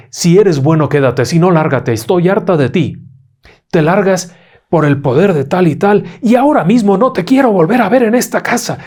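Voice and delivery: loud, rising banishing command; mother standing firm commanding the spirit to leave, loud and rising; commanding exorcism. A narrator's reading. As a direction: cast the spirit out with full authority. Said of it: "Se para en medio de la habitación y dice".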